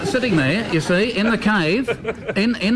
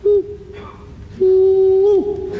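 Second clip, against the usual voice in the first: bunyip reply call; 12 semitones higher than usual. Retold or imitated. Old bloke voices the bunyip answering from the cave, high whoops. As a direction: call back like the beast in the cave. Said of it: "You'll hear the bunyip at the back of the cave reply".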